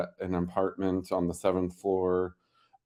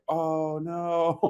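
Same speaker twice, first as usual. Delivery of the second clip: retold dismay; 8 semitones higher than usual. Retold reaction to wild kitten.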